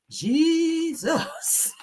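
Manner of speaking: high laughing Jesus. Real, not acted. Laughing at the story.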